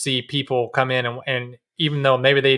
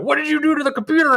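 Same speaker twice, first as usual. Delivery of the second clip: much higher, fast, panicky father voice; imitated freaked-out dad; 13 semitones higher, faster than usual. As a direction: play the startled dad finding a tinkered computer. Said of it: "freaked out when he saw me".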